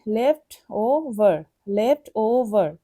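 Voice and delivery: Left over said slowly, split into its parts; syllabic division. Shown as a demonstration. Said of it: "syllabic ডিভিশনটা দেখে নি". (Left over ধীরে ভেঙে ভেঙে বলা)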